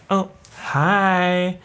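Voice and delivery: high, drawn-out American greeting; American accent. Retold or imitated. Greeting the tutor at his bench, in an American accent. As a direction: put on a bright American "hi". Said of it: "I don't know why I became American".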